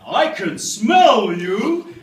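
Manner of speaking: very loud, high, hugely drawn-out holler; shouted cartoon-dog line. Dad jumping out at supposed son, shouting playfully. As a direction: leap out bellowing the dog's catchphrase. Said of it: "and I went".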